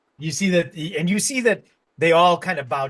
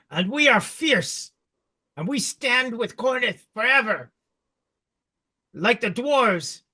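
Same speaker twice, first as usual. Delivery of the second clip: goblin king's proud speech; 6 semitones higher than usual. Acted. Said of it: "And he goes".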